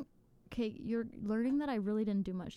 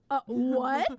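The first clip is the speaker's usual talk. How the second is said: loud, incredulous what; indignant what; 6 dB louder than usual. Retold or imitated. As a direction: sound stunned and offended. Roommate's unfair comeback retold.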